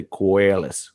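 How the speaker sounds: slow Australian "ko-ay-las"; Australian accent. Shown as a demonstration. Host models accent for cohost to try, Australian. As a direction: draw out "koalas" the Australian way. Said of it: "you can try it".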